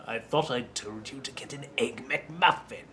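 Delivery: lightly acted line; quoted movie line. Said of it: "the line the producers quote the most".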